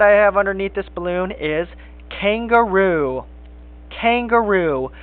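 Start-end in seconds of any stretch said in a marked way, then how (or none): 2.1-4.9 "kangaroo" stretched out slowly, x0.5; slow clear pronunciation. Shown as a demonstration. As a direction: draw out "kangaroo" syllable by syllable for kids. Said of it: "Where do you hear the k".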